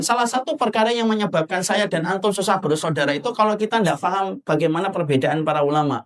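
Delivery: ordinary talk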